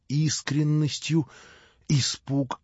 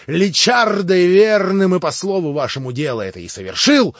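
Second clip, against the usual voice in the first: loud, higher servant's voice pressing the point; loud bitter accusation; accusing cry; 8 semitones higher, 11 dB louder than usual. Acted. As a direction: throw it in his face. Smerdyakov's accusing climax.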